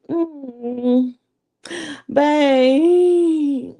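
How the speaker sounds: high, loud coaxing "Mm, babe"; sweet-talking babe. Retold or imitated. Calling her man to ask for money, coaxing. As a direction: coax him like a sugary girlfriend.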